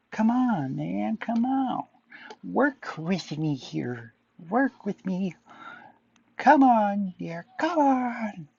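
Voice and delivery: high, drawn-out pleading; pleading silly voice. Acted. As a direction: beg the computer, high and slow. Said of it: "stupid voices".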